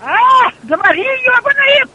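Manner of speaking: loud, high angry phone shout; yell, the line is bad; to someone hard of hearing. Real, not acted. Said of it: "Ну, ты не слышу".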